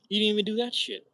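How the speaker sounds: loud exasperated shout; heated shout. Real, not acted. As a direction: yell it at the failing team. Ranting at team's defense.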